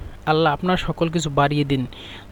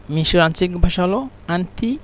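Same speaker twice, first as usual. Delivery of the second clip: ordinary talk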